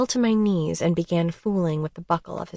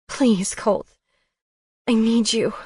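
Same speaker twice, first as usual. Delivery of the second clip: higher, pleading voice; needy plea; 4 semitones higher, 3 dB louder than usual. A narrator's reading. Drunk and begging Colton. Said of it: "I sounded weak and needy".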